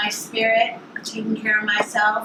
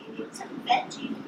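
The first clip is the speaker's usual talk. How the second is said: soft unvoiced whisper; 11 semitones higher, 10 dB quieter than usual. Real, not acted. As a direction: whisper it to yourself. Whispered aside while cooking.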